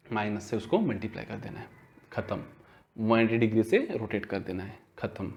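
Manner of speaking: low, soft, matter-of-fact answer; hushed summing-up. Real, not acted. Teacher wrapping up a rule for students, hushed summing-up. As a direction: drop the voice right down for the takeaway. Answers own question as quick summary.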